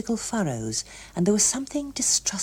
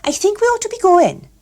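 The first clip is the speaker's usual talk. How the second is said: put-on abrupt young woman; 7 dB louder than usual. A narrator's reading. Gloria abruptly standing up.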